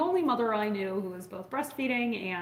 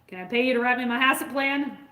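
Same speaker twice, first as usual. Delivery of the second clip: louder, higher imitated Maine accent; old Maine accent; 4 semitones higher, 7 dB louder than usual. Cattle rancher offering a vet paid work, imitated Maine accent. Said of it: "in this beautiful old Maine accent".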